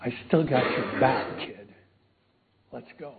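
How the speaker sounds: a still small voice, barely there; gentle near-hush of reassurance; small quiet voice. Retold or imitated. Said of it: "in this real little small voice".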